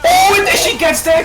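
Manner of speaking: high, loud caster yell; excited shout. Real, not acted. Caster reacting to a big play.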